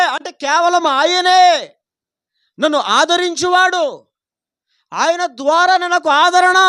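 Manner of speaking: loud, slow proclaiming of scripture; preaching at full force. Acted. Preacher to his congregation, proclaiming the psalm loudly. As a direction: preach it out to the whole hall.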